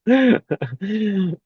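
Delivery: real laugh bursting out high; high burst of laughter. Real, not acted. Listener cracks up at her Pidgin impression, laughing high.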